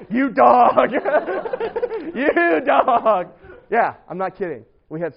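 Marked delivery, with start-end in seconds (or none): 0.1-3.3 loud, high teasing call through laughter; laughing tease. Retold or imitated. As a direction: crack up while playfully ribbing a friend. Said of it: "Sharon's like".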